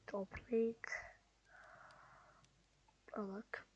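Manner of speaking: whispered to himself, barely voiced. Real, not acted. Sorting items, whispering to himself. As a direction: murmur it under your breath.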